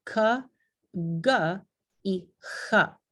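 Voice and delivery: k, g y j, slowly, tongue back against the velum; slow, careful k, g y j; velar sounds demo. Shown as a demonstration. Teacher showing how velar consonants are pronounced, slowly. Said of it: "así se pronuncian las consonantes".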